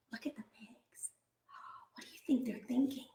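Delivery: hushed whisper to the class. Real, not acted. Teacher whispering to listening children. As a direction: whisper it like a secret. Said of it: "teacher whispers to class".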